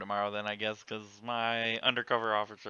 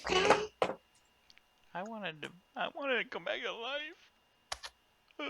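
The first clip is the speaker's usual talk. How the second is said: mock lament; 13 semitones higher, 4 dB quieter, much slower than usual. Mourning own death in game.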